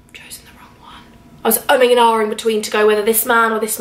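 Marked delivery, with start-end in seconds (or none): 0.1-1.0 soft whispered aside, much softer; panicked whisper. Retold or imitated. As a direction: hiss the realisation under your breath. Panicked realisation at station.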